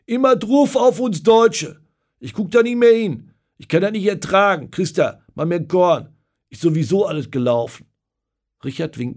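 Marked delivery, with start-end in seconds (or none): 0.1-7.8 loud, high, wailing complaint; despairing shout. Acted. Paul at the bar as Hungary scores again, loud despair. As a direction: cry out that it is all lost. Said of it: "Paul ruft".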